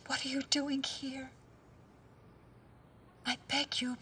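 frightened urgent whisper. Acted. Whispering to the visitor before Farhad comes, afraid. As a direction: beg her in a hushed whisper to go. Begging visitor to leave secretly.

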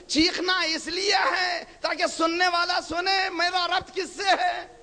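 high, drawn-out pulpit cry; high, fervent exhortation; impassioned preaching. Real, not acted. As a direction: cry it out slowly to the congregation. Preaches at full force on crying out.

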